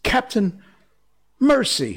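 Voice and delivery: very high, pleading whimper; trembling whimper. A narrator's reading. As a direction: beg for your life in a tiny high voice. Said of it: "Starkey whimpered, all of a tremble".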